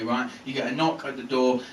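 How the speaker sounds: ordinary talk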